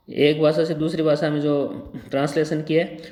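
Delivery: ordinary talk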